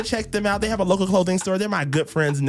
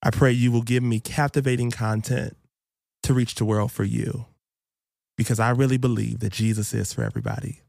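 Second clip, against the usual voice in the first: prayer; 10 semitones lower than usual. Real, not acted. Opening prayer before the show.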